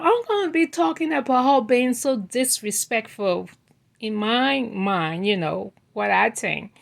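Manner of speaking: ordinary delivery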